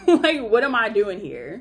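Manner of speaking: real laugh breaking through the words; a burst of amused disbelief. Real, not acted.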